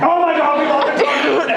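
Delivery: high, fast panicked gush; mock freak-out. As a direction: blurt it out in hammed-up alarm. Retold freak-out on the phone.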